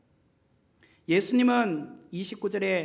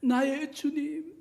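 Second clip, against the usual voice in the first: trembling; 4 semitones higher, much slower than usual. Acted. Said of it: "입술에는 부들부들부들 떨면서".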